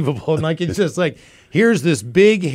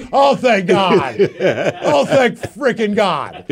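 slow, high laughing thank God; laughing relief; 6 semitones higher, much slower than usual. Retold or imitated. Player escaping the angry team, slow laughing relief. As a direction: breathe out relief through laughter. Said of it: "I was like".

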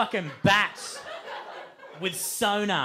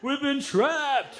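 high, slow, stretched-out wail; hammed-up bedraggled swamp creature crying out; swamp monster wail; 9 semitones higher, 3 dB louder, much slower than usual. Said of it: "These fucking swamp monsters".

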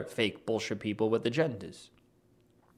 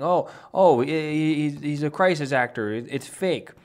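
mocking; 4 semitones higher, 5 dB louder than usual. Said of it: "the left is saying that".

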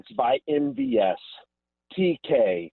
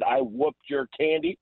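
mock Mahomes boast; 12 semitones higher than usual. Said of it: "Mahomes three sixteen just said".

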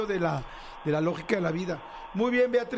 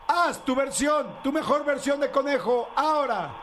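excited cue; 6 semitones higher than usual. Real, not acted. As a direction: hype the caller up to perform. Host cues caller to imitate.

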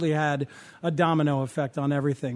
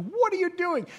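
alarmed call; 12 semitones higher, 3 dB quieter than usual. Retold or imitated. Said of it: "and it's like".

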